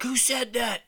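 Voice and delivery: high-pitched indignant call; acting out a challenge into the dark; retold yelling back. Man calling out to a hidden heckler, indignant yell. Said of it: "we're yelling back at him".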